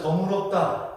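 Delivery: "정의롭다" said as jeong-eu-rop-da, Jeolla style; Jeolla pronunciation. Shown as a demonstration. Preacher shows Jeolla speakers saying just, Jeolla eu for ui. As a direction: Jeolla accent, reducing 의 to eu in "정의롭다". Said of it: "뭐 이렇게 된다는 거예요".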